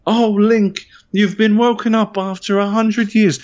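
high-pitched mock princess voice; hammed-up parody of woeful voice acting; Zelda. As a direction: put on a high, sustained game-heroine voice. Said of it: "probably a better impression of Zelda".

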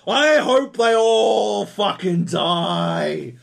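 slow, loud, raging shout; slow furious hammed-up rant at the game; furious yell. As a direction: scream it like you've had enough. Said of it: "And you're like".

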